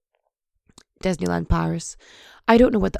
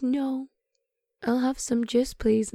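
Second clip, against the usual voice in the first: lightly voiced child, higher and softer; soft, raised kid voice for a quote; imitated little daughter; 5 semitones higher, 3 dB quieter than usual. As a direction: imitate a small child politely declining. Mother voices her daughter declining food.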